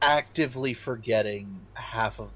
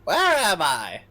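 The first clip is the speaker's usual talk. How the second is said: loud sharp voice; 8 semitones higher, 7 dB louder than usual. Pretend. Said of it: "Can you stop yelling in my ear".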